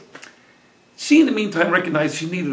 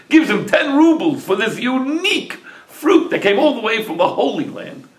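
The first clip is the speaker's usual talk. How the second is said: plain reading voice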